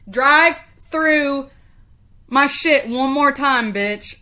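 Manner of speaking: real angry shout, drawn-out words; angry threat. Real, not acted. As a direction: loudly warn off a careless driver. Yelling at careless neighbour driver.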